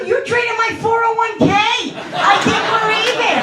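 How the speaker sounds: high, exasperated comeback; mock-outraged mother, high-voiced; indignant mom retort. Mother snaps back at son.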